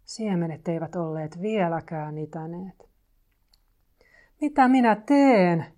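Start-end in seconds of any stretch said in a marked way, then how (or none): 4.4-5.7 loud, high, drawn-out wail; despairing cry. A narrator's reading. Kurnu cries out over seeds that will not sprout, despairing shout. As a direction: cry out at a loss, stretching it. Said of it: "Kurnu huusi".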